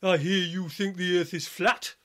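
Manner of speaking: lightly imitated Middlesbrough lad; Middlesbrough accent. Young lad challenges him about Flat Earth, in an imitated Middlesbrough accent. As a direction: put on a touch of a Middlesbrough accent. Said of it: "Middlesbrough accent".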